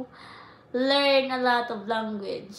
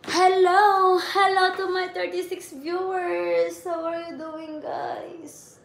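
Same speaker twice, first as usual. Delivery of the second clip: excited greeting; 5 semitones higher than usual. Real, not acted. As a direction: call out the hello with glee. Greeting livestream viewers.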